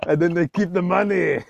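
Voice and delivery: hammed-up Austrian accent; Arnold Schwarzenegger. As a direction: keep up the comic Austrian action-star accent. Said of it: "do an Arnold Schwarzenegger accent".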